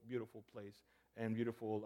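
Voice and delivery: normal talking voice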